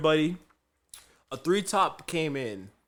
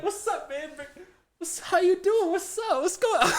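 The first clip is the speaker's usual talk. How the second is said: put-on very high, excited old-buddy act; over-familiar greeting. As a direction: greet him like you've known him for years. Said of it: "like you know this man for years".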